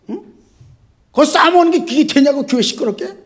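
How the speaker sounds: loud, high scolding question; pastor's scolding. Retold or imitated. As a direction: scold him like a furious pastor. (높고 크게 꾸짖는 목사 말투)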